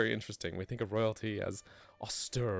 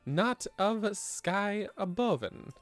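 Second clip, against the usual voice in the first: high strained reading; 9 semitones higher, much slower than usual. Real, not acted. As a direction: voice climbing high on hard words. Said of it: "your voice gets all high when it's harder to read".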